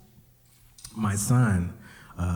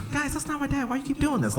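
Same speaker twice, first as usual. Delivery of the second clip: put-on very high, whiny child voice; exasperated little kid; 4 dB quieter, faster than usual. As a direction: squeak out a tired protest.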